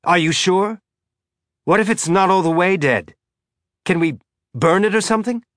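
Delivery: high, loud rising panic; climbing voice. Acted. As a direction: voice keeps climbing in alarm. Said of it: "his voice continuing to climb".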